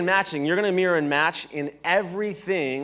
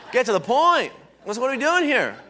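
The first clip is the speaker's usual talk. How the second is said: higher, louder mock impatience; impatient New Yorker; 6 semitones higher, 4 dB louder than usual. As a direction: play an impatient New York listener cutting in. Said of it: "to a New York person, they're gonna be like".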